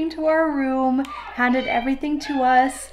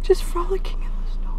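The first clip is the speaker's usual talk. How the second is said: whispering; 8 semitones higher than usual. Real, not acted. Whispered aside.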